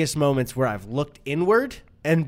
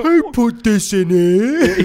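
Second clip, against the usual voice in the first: louder, slow dopey cartoon man; blob-man claymation voice; 6 semitones higher, 8 dB louder, slower than usual. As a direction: play a dim claymation blob. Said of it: "like a claymation character that's like a blob of a man".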